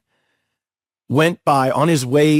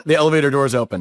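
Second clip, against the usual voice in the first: usual speaking voice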